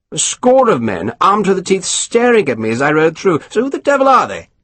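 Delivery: lightly voiced self-satisfied officer; a hint of a smug English colonel; pompous colonel. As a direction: savour each word, pleased with yourself. Colonel indignant.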